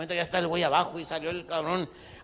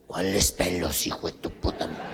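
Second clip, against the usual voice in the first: menacing low whisper; 9 semitones lower than usual. Acted. Armed compadre's menacing question.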